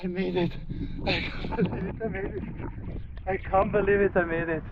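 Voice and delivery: breathless thrilled disbelief; buzzing after the icy plunge; excited. Real, not acted. Just finished the ice dip.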